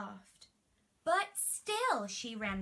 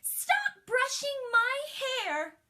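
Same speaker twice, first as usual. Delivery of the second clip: high, loud, drawn-out complaint; whiny princess shout; 13 semitones higher, 9 dB louder, slower than usual. Acted. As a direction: wail it like a spoiled little girl. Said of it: "cried the princess".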